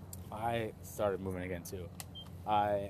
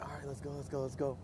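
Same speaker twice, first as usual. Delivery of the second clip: higher, hushed eager urging; keyed up but keeping it down; excited urging; 4 semitones higher, 7 dB quieter than usual. Retold or imitated. Hunter pressing his friend to start tracking, hushed excitement. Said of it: "freaking out".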